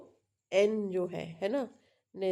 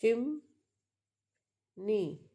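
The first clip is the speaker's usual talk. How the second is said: drawn-out demo; much slower than usual. Shown as a demonstration. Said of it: "ये लिखा है".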